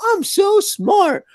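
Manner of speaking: slow, high smug voice; smug mock. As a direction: act pleased with yourself, drawn out.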